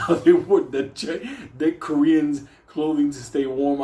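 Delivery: ordinary talk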